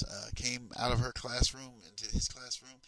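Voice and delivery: ordinary delivery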